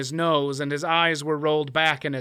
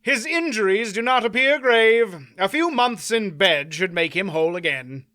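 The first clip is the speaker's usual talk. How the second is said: raised, light courtly ask; courteous suggestion; 5 semitones higher, 4 dB louder than usual. A narrator's reading. Knight asks the crowd to carry off the loser, courteous. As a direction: suggest it politely to the watching knights.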